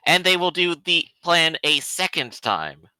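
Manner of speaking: normal talking voice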